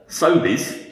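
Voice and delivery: ordinary delivery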